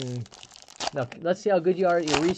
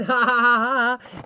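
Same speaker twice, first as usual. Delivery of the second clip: high, loud mock laugh; imitated laughter; 8 semitones higher, 7 dB louder, slower than usual. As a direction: act out the wife's laughing, spelled out as ha-ha-ha. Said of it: "And she's laughing".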